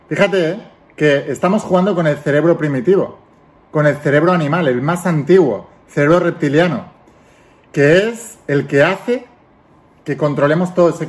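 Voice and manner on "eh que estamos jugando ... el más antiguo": ordinary talk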